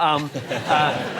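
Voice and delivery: ordinary delivery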